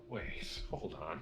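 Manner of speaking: hushed double take. Acted. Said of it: "And they're like".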